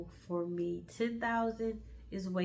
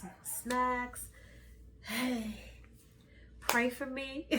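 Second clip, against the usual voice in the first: usual speaking voice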